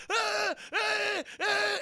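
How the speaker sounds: high, loud mock screams of pain; over-the-top agony screams; hammed-up pained screams. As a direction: scream like your belly is being eaten. Cop burned by rat.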